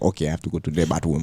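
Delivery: hint of a Haitian uncle's accent. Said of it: "the thickest Haitian accent".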